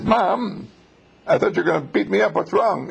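a hint of a worried son; startled son. Startled son voiced in the story.

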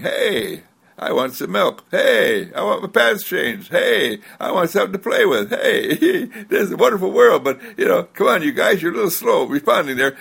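put-on high, fast demanding baby voice, laughing in places; baby's voice. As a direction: play a baby calling for milk and attention. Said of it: "It's saying".

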